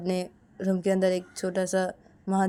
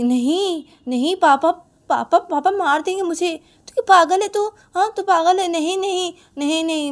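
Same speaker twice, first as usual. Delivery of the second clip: high, loud panicky protest; acted agitated outburst, voice raised; alarmed refusal; 10 semitones higher, 9 dB louder than usual. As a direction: refuse as if the idea is crazy. Uma alarmed at sister's proposal.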